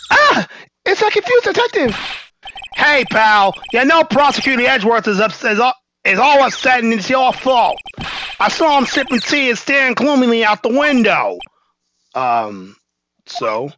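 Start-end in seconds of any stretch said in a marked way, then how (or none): 0.1-11.4 put-on New York accent. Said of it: "I gave him my New York accent".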